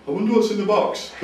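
high, naive wondering voice; clueless. Acted. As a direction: play yourself as innocently dim and curious. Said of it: "I'm not very switched on".

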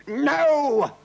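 high, loud refusal; roaring king. A narrator's reading. King refusing the crowd's plea, roaring. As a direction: roar a royal refusal. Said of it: "roared the king".

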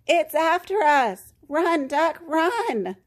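high-pitched alarm; storybook panic, pitched well up; crying out. A narrator's reading. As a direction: cry out a warning to flee. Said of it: "cried Goose".

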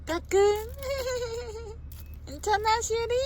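high, sing-song peekaboo voice. Real, not acted. Peekaboo to baby, high pitch.

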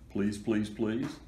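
put-on mildly begging child; pleading. A narrator's reading. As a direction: beg lightly, one please after another. Begging to keep puppy inside.

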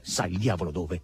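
usual speaking voice

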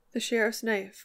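ordinary delivery, nothing marked

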